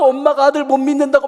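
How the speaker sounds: put-on high, loud childish lament; hammed-up tearful complaint; mock wailing. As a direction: imitate a bawling, wronged kid. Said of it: "막 펑펑 울어 제끼니까".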